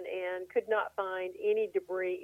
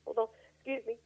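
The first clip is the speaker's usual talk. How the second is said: usual speaking voice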